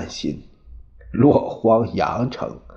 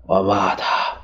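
plain reading voice

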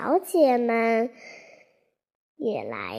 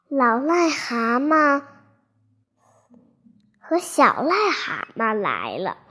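ordinary delivery

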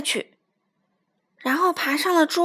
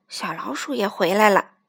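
usual speaking voice

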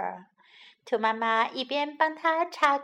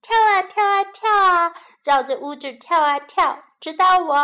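excited; 6 semitones higher, 9 dB louder than usual. Acted. Child bouncing game.

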